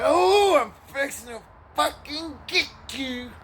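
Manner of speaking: high shriek into slow threat. Retold or imitated. Furious truck guy threatening the teller, high then slow. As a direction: start with a loud high yelp, then draw the threat out.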